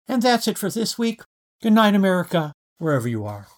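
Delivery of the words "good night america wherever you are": usual speaking voice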